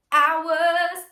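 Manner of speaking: hours started with a light glottal catch; semi-glottal attack. Shown as a demonstration. Coach demonstrating a semi-glottal attack on hours. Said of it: "sort of like a semi-glottal attack".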